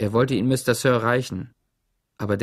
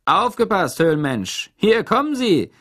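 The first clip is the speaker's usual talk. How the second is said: raised, higher teasing call; mock call out; 8 semitones higher, 5 dB louder than usual. A narrator's reading. Zickzack teases Stanley while throwing the sack, higher calling voice. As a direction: toss it out like a showman.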